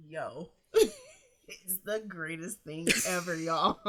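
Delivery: laughing helplessly between words; real laughter. Real, not acted. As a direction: crack up at the absurdity. Cracking up at ex's email.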